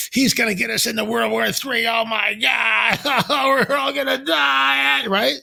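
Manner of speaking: high, hammed-up wailing shriek; mock panic. Mocking the news media's World War Three panic, high mock wail.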